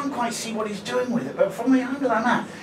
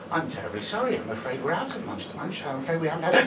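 polite apology; 4 semitones lower, 6 dB quieter than usual. Retold or imitated. Polite shop worker apologising to drunk.

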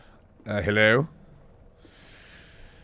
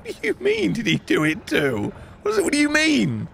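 incredulous; 11 semitones higher than usual. Real, not acted. Baffled by chat message.

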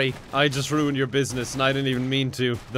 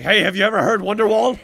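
guitar guy voice; 5 semitones higher, 5 dB louder than usual. Retold or imitated. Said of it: "It's like".

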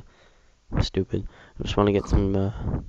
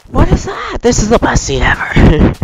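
very high, loud excited shriek; thrilled squealing; 9 dB louder than usual. Real, not acted. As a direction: squeal with delight at the sight. Thrilled at sight in the game.

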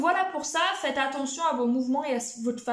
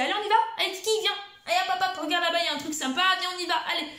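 high, fast, cheery coaxing of the dog; sing-song encouragement to get Kiki moving; upbeat motivating call; 4 semitones higher, much faster than usual. Shown as a demonstration. Trainer coaxing her dog Kiki to follow, high and bright.

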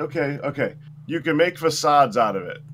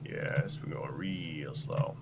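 slow drawl; 10 semitones lower, 12 dB quieter, much slower than usual. Pretend. Drawn out mock slow.

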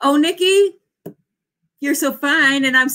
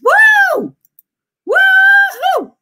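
very high, loud whoops; whooping; 7 dB louder, much slower than usual. Real, not acted. Streamer celebrating viewers' sprint results, whooping loudly. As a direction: let out a big celebratory whoop.

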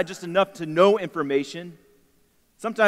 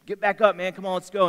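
lightly voiced coach, pitch lifted; pushy coach; 4 semitones higher, 4 dB louder than usual. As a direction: urge them back up like a coach. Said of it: "trying to be that coach".

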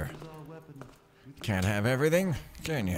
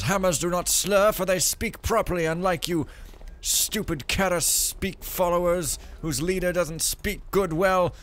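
loud, high pompous mock yell; haughty hammer zealot; 8 semitones higher, 5 dB louder than usual. Imagined hammer follower answering the insult, pompous mock shout. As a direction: sneer it like an offended zealot. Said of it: "And the hammer goes".

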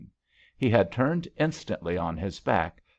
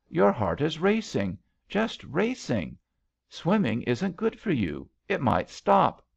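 alarmed; 7 semitones higher than usual. A narrator's reading. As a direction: voice anxious concern for the rescuer. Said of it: "came a note of alarm".

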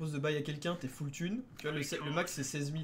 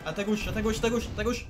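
higher, louder warning shout; urgent shouted callout; 7 semitones higher, 6 dB louder than usual. Real, not acted. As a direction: yell the warning to a teammate.

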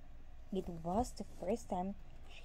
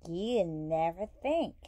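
lower, louder reproach from the wife; scolding cry; 4 semitones lower, 5 dB louder than usual. A narrator's reading. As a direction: scold him in a loud, low voice. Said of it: "cried the wife".

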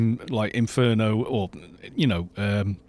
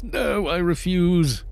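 mock refusal; 7 semitones higher, slower than usual. Retold refusal.

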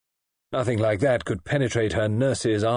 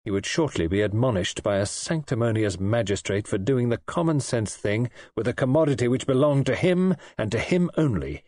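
plain reading voice

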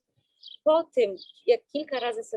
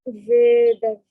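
much louder, drawn-out command; loud exhale cue; 11 dB louder than usual. Acted. Calls out exhale in practice.

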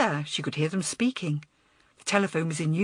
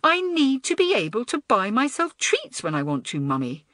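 petulant; 6 semitones higher, 3 dB louder than usual. A narrator's reading. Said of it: "Kerry was becoming increasingly petulant".

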